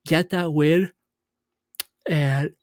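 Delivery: talking through a yawn; words stretched by a yawn. Real, not acted. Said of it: "Excuse me, I keep yawning".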